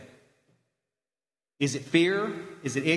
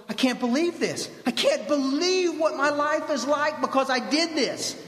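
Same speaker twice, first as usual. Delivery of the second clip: high, stretched-out astonishment; amazed disbelief; 8 semitones higher than usual. Acted. Man just through the fire marvels at his life, high amazement.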